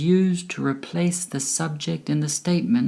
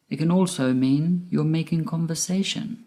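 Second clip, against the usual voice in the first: ordinary talk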